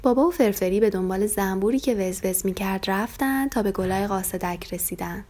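usual speaking voice

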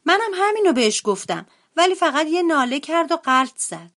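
put-on high-pitched little boy bear voice; brother bear at breakfast. A narrator's reading. Brother telling mother about sister over breakfast, high child voice.

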